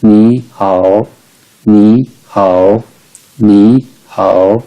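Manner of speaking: नी rising as second tone, हाओ dipping third tone; third tone turned second. Shown as a demonstration. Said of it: "second tone के साथ replace कर देते हैं".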